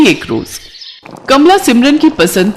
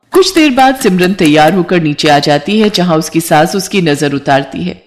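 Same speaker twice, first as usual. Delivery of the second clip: ordinary delivery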